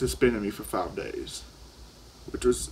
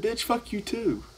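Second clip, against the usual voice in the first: much higher, slow put-on sneer; mock defiant retort; 11 semitones higher than usual. Student cursing back at the teacher, high mocking sneer. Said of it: "I said".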